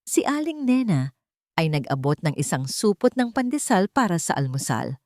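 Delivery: plain reading voice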